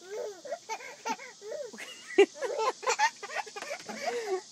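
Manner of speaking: long high giggle; high squealing laugh. Real, not acted. Parent still laughing at baby, high giggle.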